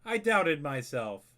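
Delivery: light up as you find it after all; happy surprise at his own mistake; relieved surprise. Real, not acted. Relief at finding the accent.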